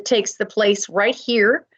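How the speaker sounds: ordinary delivery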